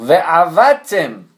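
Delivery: "و عوتم" very slow, with the guttural ayin brought out; correct ayin demo. Shown as a demonstration. Teacher insisting ayin be pronounced right, slow emphatic "و عوتم". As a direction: stretch "و عوتم", leaning on the throat consonant. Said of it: "باید این عینش رو درست".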